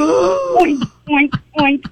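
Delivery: put-on toddler's pig noises, loud and shouted; loud pig oinks. Toddler shouting pig sounds at a stranger, loud oinking. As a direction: oink it out loud like a proud little kid. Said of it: "And she's going".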